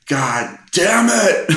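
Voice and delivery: slow, high-pitched exasperated yell; re-enacted shout of someone at the breaking point; exasperated outburst. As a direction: draw the curse out loudly, pitch jumping way up. Retold outburst as music restarts.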